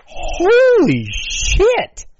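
high, loud, slow disbelief; drawn-out high shout of shock. Real, not acted. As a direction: push the shock further, bigger. Repeats exclamation louder.

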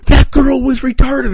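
high, loud, drawn-out mock horror; retold scandalized yell. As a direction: imitate an appalled brother breaking bad news. Brother's appalled reveal, acted out.

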